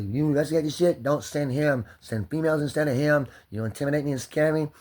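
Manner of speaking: loud, high, furious outburst at the cop; angry yelling. Real, not acted. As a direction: shout it at the police, livid.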